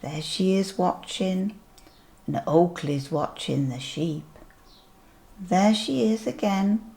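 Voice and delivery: ordinary delivery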